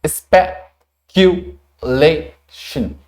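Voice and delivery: स्पेक्युलेशन drawn out very slowly, syllable by syllable; slow model pronunciation. Shown as a demonstration. Teacher slowly modelling the word for students. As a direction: spell out स्पेक्युलेशन slowly for learners. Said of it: "लेकिन बोलें कैसे?".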